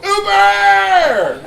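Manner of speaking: loud, high, drawn-out holler; hollering for a ride. Acted. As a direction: bellow it out like hailing a ride in the street. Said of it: "walk out of the bar and go".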